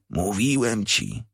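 slow, softened, mild reproach; hint of reproach. A narrator's reading. Joe to the boy after a reproving cough, mild reproach. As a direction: draw it out softly, like a quiet told-you-so. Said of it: "kaszlnął z odcieniem wyrzutu, jakby mówił".

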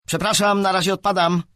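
loud, high call to the group. A narrator's reading. Boy leaves the game with his sister, calling out. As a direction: shout your excuse over to teammates.

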